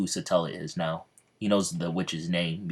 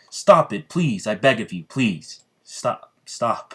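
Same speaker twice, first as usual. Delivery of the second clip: desperate pleading; 3 semitones higher, 6 dB louder, slower than usual. Acted. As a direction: beg her to stop, running out of voice. Yami begging Satella to stop.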